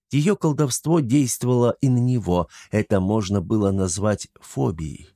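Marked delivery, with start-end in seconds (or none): none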